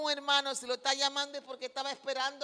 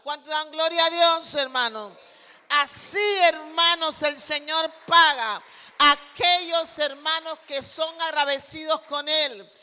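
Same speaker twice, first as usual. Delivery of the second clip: loud, rousing call to the congregation; fervent preaching; 2 semitones higher, 8 dB louder than usual. Real, not acted. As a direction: preach it out with raised voice. Exhorts congregation at full force. (predicación ferviente a viva voz)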